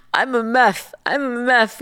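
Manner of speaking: imitated swollen-lipped mumble, lisping; Kris Jenner. Reality star after an allergic reaction, swollen-lip lisp. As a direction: mush the words through huge numb lips. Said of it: "her lips are so huge that she can't talk".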